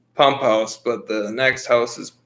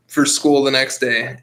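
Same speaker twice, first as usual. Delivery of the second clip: usual speaking voice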